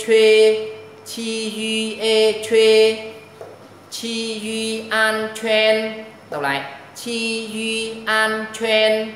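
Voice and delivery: very slow, drawn-out chant, loud on the key syllables; đánh vần thật chậm và to; slow loud spelling drill. Shown as a demonstration. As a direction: spell each syllable out for the class.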